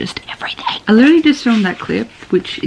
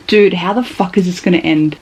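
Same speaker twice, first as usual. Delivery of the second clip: ordinary talk, nothing marked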